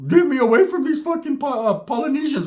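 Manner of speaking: high-pitched frantic begging; mock panicked plea. Texting his mom for rescue, high comic panic.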